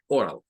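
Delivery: oral with or and all joined; English oral. Shown as a demonstration. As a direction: say oral as one clean word. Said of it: "Sería la pronunciación". (oral pronunciado en inglés, or-al)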